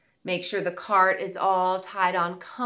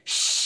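faucet shush. Shown as a demonstration. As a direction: let the air out like a tap. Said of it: "make a sound with our mouth like this".

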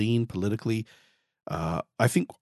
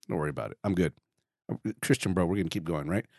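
ordinary talk, nothing marked

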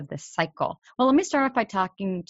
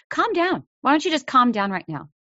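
much higher and louder, a mock scolding "calm down"; imitating an exasperated person ordering you to calm down; someone telling you to calm down; 6 semitones higher, 4 dB louder than usual. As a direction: snap it like an impatient adult. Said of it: "someone says to you".

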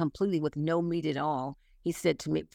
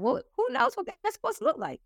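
much higher, mock-incredulous question; incredulous retort. Real, not acted. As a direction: toss it off in a high, amazed pitch. Retorting to age compliments.

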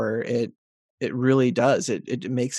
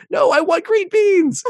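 much higher, louder and slow, a hammed-up whine; whiny child demand; 6 dB louder, slower than usual. Child refusing parent, slow loud high whine. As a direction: stretch it out like a kid who won't take no. Child insisting on adult food.

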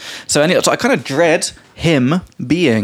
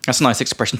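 usual speaking voice

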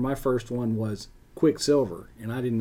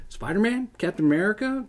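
put-on eager kid; 8 semitones higher than usual. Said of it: "looking at those going".

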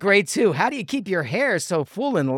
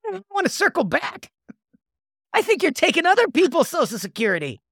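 higher accusing character voice; 11 semitones higher than usual. Acted. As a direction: play the nosy friend, pitched up. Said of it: "I'll do the Ty voice".

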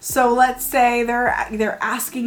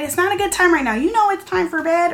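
higher, exasperated bedtime refusal; mock impatient parent; 8 semitones higher, faster than usual. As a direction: brush the kid off, impatient. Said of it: "Or instead of saying".

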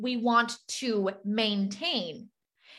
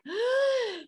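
sharp, high imitated gasp; shocked gasp; 11 semitones higher than usual. Coach acting out a client's shock, a high sharp gasp. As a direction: gasp as if the rug was pulled out. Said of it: "And you're like".

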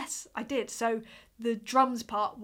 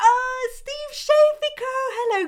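excited greeting; 13 semitones higher, 9 dB louder than usual. Real, not acted. Greeting a chat member excitedly.

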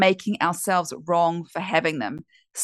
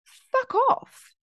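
high, emphatic "Fuck off"; forceful dismissal. Retold or imitated. Telling the inner critic to go away, high firm retort. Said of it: "the two most powerful words ever".

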